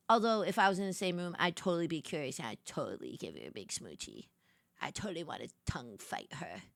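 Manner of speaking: low, soft admission; dropping low and quiet, as if confessing; confiding aside. Real, not acted. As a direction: drop the voice to a naughty murmur. Confessional aside after rant.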